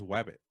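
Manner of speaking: "wabbit" with a W in place of the R. Retold or imitated. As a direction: swap the R for a W in "wabbit". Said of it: "Elmer Fudd doesn't pronounce the R".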